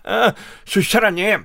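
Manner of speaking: ordinary delivery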